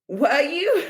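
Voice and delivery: high, laughing mock paramedic; a paramedic indulging a concussed rider, laughing; amused disbelief. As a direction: humour her through a laugh. Paramedic humouring her, retold.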